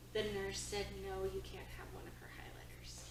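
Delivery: quiet, almost whispered report of the nurse's no; lowering the voice as if the nurse might hear; hushed retelling. Retold or imitated. Husband reporting the nurse's refusal in a hushed voice.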